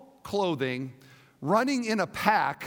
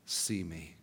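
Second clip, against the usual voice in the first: much lower and softer, almost breathed; hushed; 14 semitones lower, 10 dB quieter than usual. Acted. Reading Jesus' promise to the women, hushed and low. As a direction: let the promise fall to a hush. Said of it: "Then Jesus said to them".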